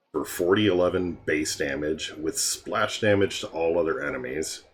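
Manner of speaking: ordinary talk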